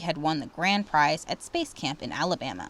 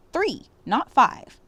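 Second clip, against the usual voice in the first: Three slow, higher and louder than five; stress on Three; 8 semitones higher, 7 dB louder, slower than usual. A narrator's reading. Teacher correcting the count, stress on Three. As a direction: hit "Three" hard and slow. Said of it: "Mister Debato yelled".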